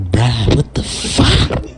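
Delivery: from muttered disbelief up to a high squeal; a mock panicked squeal of what school is this; rising disbelief. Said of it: "I was like".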